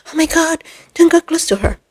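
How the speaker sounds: mock the fearful onlooker; mock alarmed Karen. Vlogger mocking a woman scared of a dancing lady, mock alarm. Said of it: "And now it becomes".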